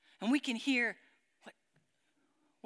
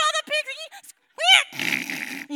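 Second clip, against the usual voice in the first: pig squeal; 9 dB louder than usual. Retold or imitated. Pigs running off.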